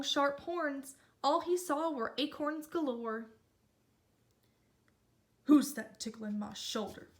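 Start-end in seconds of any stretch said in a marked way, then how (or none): 5.4-7.1 a touch lower, growing annoyed; low grumble of a sleepy bull; lightly voiced bull. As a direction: bull woken by something on his shoulder, lightly lower and annoyed. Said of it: "the big bull smoldered".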